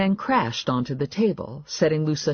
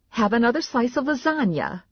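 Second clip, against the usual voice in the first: fussing mother; 10 semitones higher than usual. A narrator's reading. Narrator voices fussing mother.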